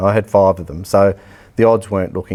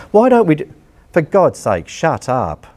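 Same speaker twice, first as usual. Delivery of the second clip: fed-up cry at whining sports bodies; exasperated outburst; 7 semitones higher than usual. Real, not acted. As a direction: snap at the complainers to shut up.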